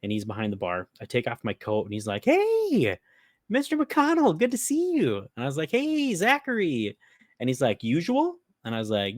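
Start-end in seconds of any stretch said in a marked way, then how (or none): 2.2-5.2 high, bright host welcome, louder; bartender greeting. Retold or imitated. Bartender greets him as regular. 5.7-7.0 high, slow, smug hello; mock regular customer. Narrator answers bartender in play. 7.8-8.4 bartender offering. Retold or imitated. Bartender offers usual drink.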